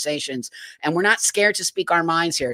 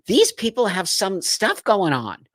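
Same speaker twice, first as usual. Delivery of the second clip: normal talking voice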